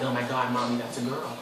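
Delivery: ordinary delivery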